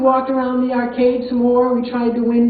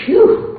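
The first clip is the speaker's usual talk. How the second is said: a high, louder sigh of relief; relieved phew; 9 semitones higher, 6 dB louder than usual. Retold or imitated. Grandmother retelling Maria's relief at the ride, relieved phew. Maria sighs next to her.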